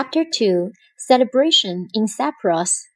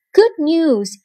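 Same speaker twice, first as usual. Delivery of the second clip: higher, louder proclamation; announced; 7 semitones higher, 4 dB louder than usual. A narrator's reading. Othello announces good news to his troops, loud and high. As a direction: proclaim it to the whole army. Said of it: "he announced".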